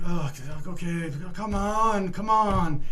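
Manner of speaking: hammed-up high cooing boss; soothing boss. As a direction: coo it high like a paternal boss shooing a child. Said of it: "And he's like".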